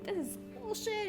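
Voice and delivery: mock angry outburst. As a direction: toss it off high and fed up, a retold rant. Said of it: "I was already angry about that".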